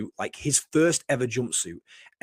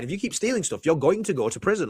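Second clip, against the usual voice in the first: ordinary talk, nothing marked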